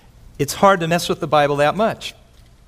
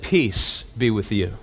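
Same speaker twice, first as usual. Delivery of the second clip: gentle greeting; 8 semitones lower, 4 dB quieter, slower than usual. Retold or imitated. Jesus greets doubting disciples gently.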